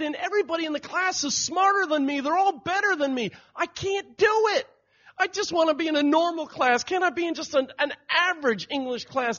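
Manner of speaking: high-pitched, hammed-up distraught daughter; mock tearful outburst. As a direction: imitate an upset teenager pleading with dad. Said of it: "she just bursts out crying".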